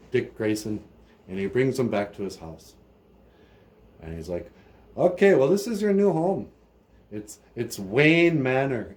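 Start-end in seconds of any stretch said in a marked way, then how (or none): 5.0-9.0 welcoming host. Retold or imitated. Bruce welcomes boy home.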